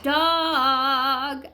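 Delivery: long, held "Dog"; drawn-out model word. Shown as a demonstration. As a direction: stretch the vowel in "Dog" for learners. Teacher models the answer word.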